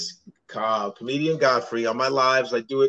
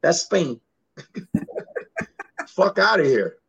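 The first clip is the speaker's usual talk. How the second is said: Dominican accent impression. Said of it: "you sound just like one of them".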